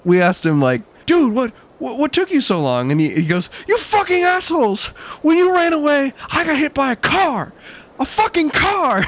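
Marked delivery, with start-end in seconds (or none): 1.0-2.9 put-on surprised kid asking. Kids asking late friend. 3.7-9.0 high, bratty angry whine; high whining tirade at his friends; whiny furious kid. Retold or imitated. Angry kid after accident.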